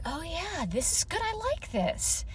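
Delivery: soft, high purr of enjoyment; hushed mock-indulgent delight; retold relish. Relishing sweets in retold thought.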